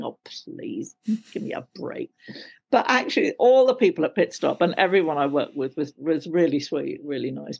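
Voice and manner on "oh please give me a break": low, soft, fed-up mutter under the breath; muttered aside. Retold or imitated. Actress scoffing at gushing actors, soft and low. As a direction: mutter it half to yourself, unimpressed. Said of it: "And I think".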